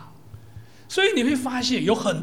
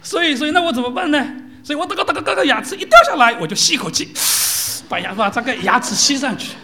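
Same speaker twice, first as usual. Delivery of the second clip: mock excited old pastor; 6 semitones higher, 8 dB louder than usual. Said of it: "他说".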